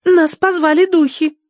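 put-on very high girlish voice; earnest girl. A narrator's reading. Daughter backs up the trip to father, girlish. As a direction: insist with naive conviction. Daughter confirms spirits called.

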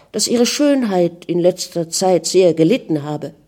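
usual speaking voice

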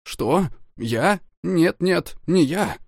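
high, panicked denial; frightened denial. Acted. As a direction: blurt it out in terror. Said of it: "от страха вытаращил глаза".

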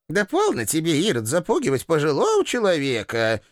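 put-on shrill-free high old woman's rebuke; old landlady. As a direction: shoo him off like a cranky granny. Landlady retorts.

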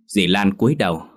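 plain reading voice

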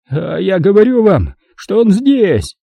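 insisting, voice high and frantic, slowing at the end; half-mad boss shrieking his certainty; shrill panicked insistence; hysterical shriek. A narrator's reading. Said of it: "Истерично взвизгнул патрон казино".

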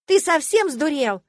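slow, high, loud indignant yell. A narrator's reading. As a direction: yell it out, outraged. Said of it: "завопила я". (возмущённый вопль)

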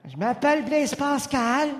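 a touch of ghostly Pascal; dazed philosopher. Acted. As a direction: insist slowly on your name. Acted Pascal voice.

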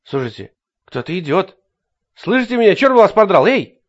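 panicked shout, voice climbing high; frightened yelling. A narrator's reading. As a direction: call out in rising panic. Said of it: "уловил в его голосе страх". (испуганный крик)

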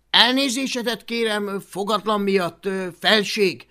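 high, loud pleading apology; Hablaty apologizing. Acted. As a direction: flatter royalty with a hesitant apology. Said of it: "szabadkozott Hablaty".

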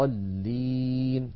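الضالين held long on the ا before the doubled ل; Quranic recitation. Shown as a demonstration. Teacher recites the screen example, very slowly. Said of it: "نحو".

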